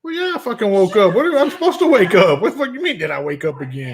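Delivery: amused indignant reply; laughing mock defiance. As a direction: laugh while protesting. Answering his wife's jab.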